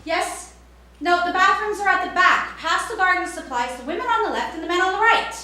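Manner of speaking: high, loud, rattled-off directions; brisk shop clerk. Retold or imitated. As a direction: rattle off directions fast and chirpy. Clerk answers passing customer.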